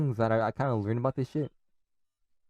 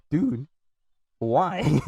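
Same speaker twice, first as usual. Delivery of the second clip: high, loud, incredulous protest; mock exasperation; 5 semitones higher, 6 dB louder than usual. Spanish learner answering people who test them, exasperated. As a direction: plead loudly, ready to laugh. Acted reply to being questioned.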